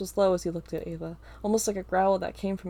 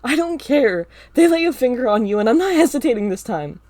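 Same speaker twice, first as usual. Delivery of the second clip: defiant shout; 5 semitones higher, 13 dB louder than usual. Acted.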